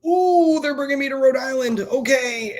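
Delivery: mock the lazy guys sliding by; lazy slacker. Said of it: "it's like".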